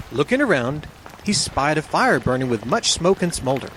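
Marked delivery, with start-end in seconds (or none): none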